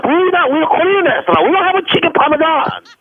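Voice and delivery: indignant role. Acted. Scene: prankster insisting to the owner it's a Korean restaurant, indignant. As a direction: insist you're a Korean place.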